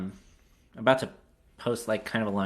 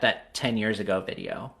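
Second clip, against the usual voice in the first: ordinary delivery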